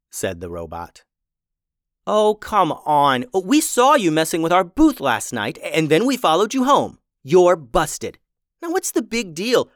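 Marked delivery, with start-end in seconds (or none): 2.1-9.7 angry. Acted. Louisa confronts the robots.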